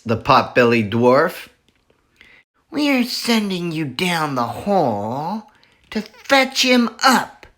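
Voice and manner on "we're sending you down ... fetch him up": high character voice; scheming Bean. Acted. Bean ordering Bunce down the hole, sneering. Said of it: "said Bean".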